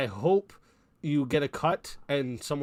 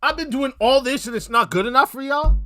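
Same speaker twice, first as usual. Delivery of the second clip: mock complaint; 8 semitones higher, 8 dB louder than usual. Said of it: "If I was to say".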